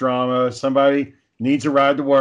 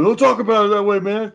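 pissed-off retort; 8 semitones higher than usual. Retold or imitated. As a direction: retell Rocky snapping back, irritated. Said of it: "Rocky gets pissed".

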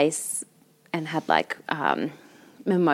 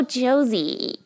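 drawn-out American "Josie" with a Z; imitated American accent; 5 semitones higher, much slower than usual. American finally getting the name, drawn-out American accent. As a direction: stretch "Josie" out in dawning American recognition. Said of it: "I don't talk like that".

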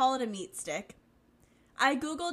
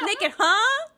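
high, loud game-show host reaction; mock-incredulous host saying "nekkid"; Steve Harvey; 10 semitones higher, 11 dB louder than usual. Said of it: "The fucking Steve Harvey on the Family Feud joke".

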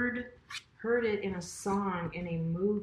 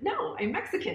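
higher-pitched retold protest; puzzled denial; 11 semitones higher than usual. Correcting a man who took her for Indian, puzzled denial. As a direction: correct him, voice jumping up.

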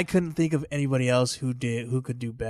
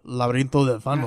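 "Laberinto del Fauno" said with a native Spanish accent; Spanish pronunciation. Real, not acted. Friend praises his accent: native Spanish title. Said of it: "I love how you say that with your accent too".

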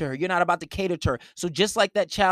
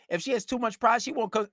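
normal talking voice

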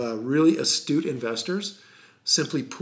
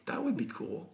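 snake oil seller; 4 semitones higher, 9 dB quieter than usual. Acted. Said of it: "You sound like a snake oil salesman right now".

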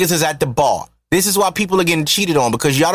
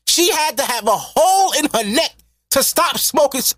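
getting excited; 8 semitones higher than usual. Real, not acted. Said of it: "I'm getting excited".

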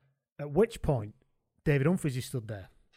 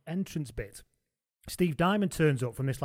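ordinary talk

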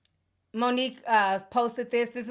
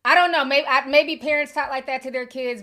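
high, loud bewildered rant; worked-up, high and loud; exasperated rant; 5 semitones higher, 10 dB louder than usual. Real, not acted. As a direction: vent your disbelief. Ranting about weird phrase.

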